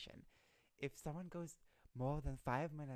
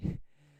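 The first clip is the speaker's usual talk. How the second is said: short laugh; 4 semitones higher, 9 dB louder than usual. Real, not acted. Laughs at own cute-voice remark.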